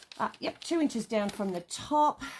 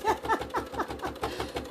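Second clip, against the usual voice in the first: high giggle. Real, not acted. Laughs at her own joke.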